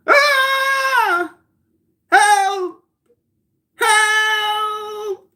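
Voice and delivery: very high theatrical scream; hammed-up nightmare scream; screaming for help. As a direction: shriek and stretch out each cry.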